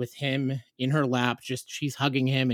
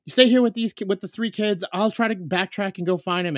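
loud, high urgent instructions, easing off; panicked orders; 8 semitones higher, 7 dB louder than usual. Retold or imitated. Dad to wife before searching for son, panicked urgency. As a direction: take charge in a retold panic.

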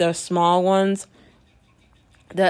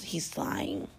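whispered; 12 semitones lower, 14 dB quieter than usual. Retold or imitated. Friend's texts read out.

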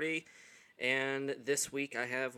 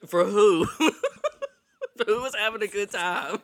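teasing; 9 semitones higher, 11 dB louder than usual. Real, not acted. Teasing co-host.

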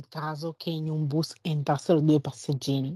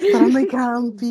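laughing; 5 semitones higher, 5 dB louder than usual. Real, not acted. Repeats it laughing.

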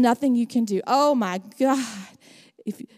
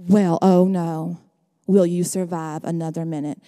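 sarcastic; 6 semitones lower than usual. Acted. Sarcastic reply to the kid.

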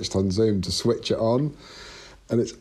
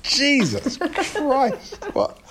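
much higher, slow, laughing disbelief; laughing mock fright at the chess set; mock shock; much slower than usual. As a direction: gasp it out, spooked and amused. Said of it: "You're like".

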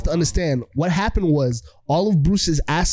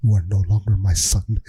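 deep, stern disowning father; Bruce; 10 semitones lower than usual. Retold or imitated. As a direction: pronounce it low and final. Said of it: "Bruce comes in and he's like".

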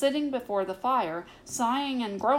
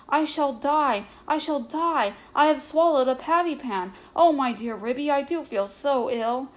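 high, loud theatrical wail; howling; 5 semitones higher, 5 dB louder than usual. A narrator's reading. Duchess to Ribby, sure she swallowed the pan, howling. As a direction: cry out in alarm, pitched up. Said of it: "Duchess set up a howl".

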